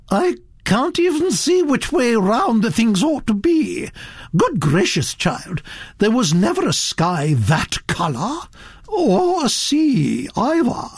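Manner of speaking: high, swooping, indignant criticism; frank, scornful grande dame; unsympathetic Duchess. A narrator's reading. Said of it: "The Duchess was, frankly, unsympathetic".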